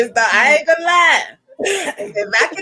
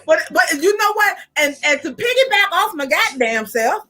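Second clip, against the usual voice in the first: ordinary talk, nothing marked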